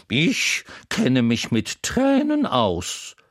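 put-on a chatty horse teasing the crying boy; Troubadour the talking horse. A narrator's reading. Horse voice held.

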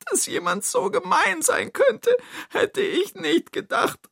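crying character in a high, thin pitch; tearful. Acted. Toni sits weeping over the mean thief, high and tearful. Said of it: "Toni sitzt da und weint".

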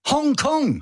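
Hong Kong sung out high and bright; high emphatic. Real, not acted. Repeated answer, pitched way up.

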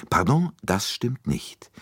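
slow, firm contradiction; emphatic. A narrator's reading. Poirot contradicting Bouc, slow and emphatic. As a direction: lay down each word. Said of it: "widersprach Poirot mit Nachdruck".

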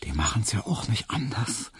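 whispered with a smile, lightly teasing; soft, airy conspiratorial whisper; smiling whisper. A narrator's reading. Hedwig reassuring Emil on the park bench, smiling whisper. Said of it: "da flüsterte ihm Hedwig lächelnd zu".

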